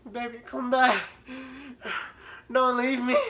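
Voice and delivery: high mock plea; hammed-up begging; high pleading whine. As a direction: whimper like an abandoned lover. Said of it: "I was like".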